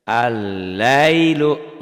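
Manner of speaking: Al-lailu chanted slowly and loudly, doubled l; recited Arabic example. Shown as a demonstration. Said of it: "nggak dibaca". (Al-lailu dibaca panjang, lam melebur)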